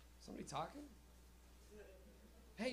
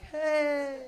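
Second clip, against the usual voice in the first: cheery hey; 6 semitones higher, 9 dB louder than usual. Retold or imitated. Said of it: "They're like".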